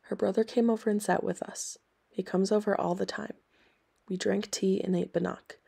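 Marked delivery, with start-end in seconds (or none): none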